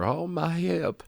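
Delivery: high, slow mock plea; drawn-out, high-pitched imitation pleading. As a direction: whimper it as a hammed-up injured victim. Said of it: "Pearl pleads to her".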